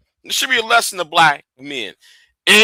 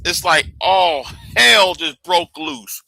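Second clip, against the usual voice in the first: normal talking voice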